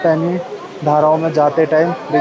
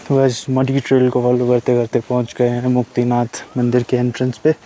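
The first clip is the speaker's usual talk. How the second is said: ordinary talk, nothing marked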